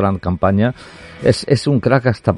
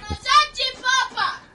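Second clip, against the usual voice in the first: high, slow, drawn-out call; stretched falsetto call; 7 dB quieter, much slower than usual. Pretend. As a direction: sing the name out long and high. Announcing the mascot's arrival. (llamada aguda y alargada)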